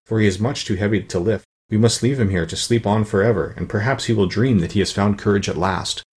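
ordinary delivery, nothing marked

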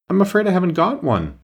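high, timid reply; a child caught without a ticket, nervous and high; frightened. Acted. Alice answers the guard, frightened and high. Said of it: "Alice said in a frightened tone".

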